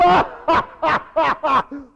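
loud, high mocking cackle; hammed-up taunting ha-ha; jeering laugh. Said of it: "he jeered him".

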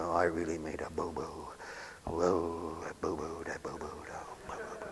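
low, soft mumbling to yourself; dejected self-reproach. Acted. Downcast worker sits going over boo-boos, low dejected muttering. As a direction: brood over it, fading into a near whisper. Acts out brooding over mistakes.

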